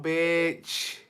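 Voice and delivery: usual speaking voice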